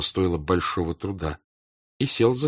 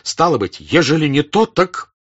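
agitated; 5 semitones higher, 6 dB louder than usual. Acted. Rostov agitated confrontation.

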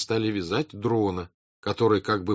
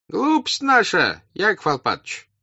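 crowd answering; 11 semitones higher, 5 dB louder than usual. A narrator's reading. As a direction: own up in a high, loud voice. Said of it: "отвечали голоса".

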